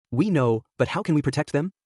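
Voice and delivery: plain reading voice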